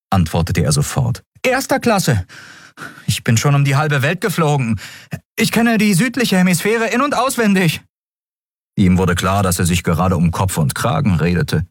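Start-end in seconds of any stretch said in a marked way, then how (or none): 1.4-7.8 put-on much higher, eager boy's voice, bragging; a nervous boy overselling himself; boastful young Miko. Miko bragging to the captain, high and eager.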